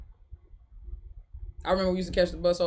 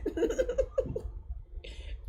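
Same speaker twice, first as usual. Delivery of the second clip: breathy high giggle trailing off; laughing; 11 dB quieter than usual. Real, not acted. Streamer reading viewer comments, laughing. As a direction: crack up helplessly.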